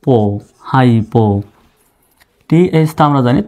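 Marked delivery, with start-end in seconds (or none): none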